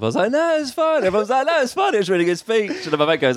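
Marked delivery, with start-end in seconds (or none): 0.3-1.0 false reassurance. Retold or imitated. Friend reassuring drunk speaker after her flop, high-pitched placating. 1.5-2.7 imitated high-pitched placating; false reassurance. Guests reassuring the drunk speaker about her speech, high and placating.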